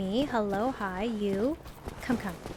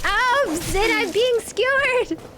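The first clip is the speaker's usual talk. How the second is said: pained yelp; 10 semitones higher than usual. Real, not acted. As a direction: yelp while getting skewered. Crying out while hit.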